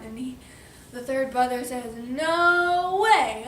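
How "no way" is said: high, loud storybook refusal; defiant pig. Acted. Pig refuses the wolf, high loud defiance. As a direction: stretch it out stubbornly.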